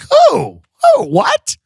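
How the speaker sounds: very high, loud mock confusion; hammed-up startled questioning; baffled customer. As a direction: squawk it in utter bewilderment. Acts out baffled customer on phone.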